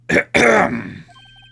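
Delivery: loud, high, hammed-up throat clear; overblown throat clearing. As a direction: clear the throat as big as possible. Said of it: "most overtop clearing of the throat".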